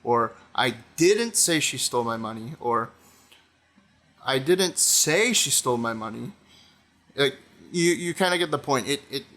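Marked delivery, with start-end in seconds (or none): 0.5-2.5 "didn't" stressed with a rise. Shown as a demonstration. Showing viewers how stress changes meaning, stress on "didn't". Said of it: "if you put the emphasis on any single word". 4.2-6.3 "say" stressed with a rise. Shown as a demonstration. Showing viewers how stress changes meaning, stress on "say". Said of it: "if you put the emphasis on any single word".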